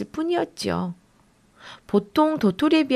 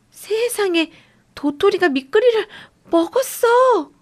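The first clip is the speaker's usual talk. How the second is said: breathless; 10 semitones higher than usual. Acted. Wide-eyed boy telling his sister the acorn ate the slug, breathless. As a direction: gasp it out in shock. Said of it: "숨 넘어갈 듯한 소리로 말했어요".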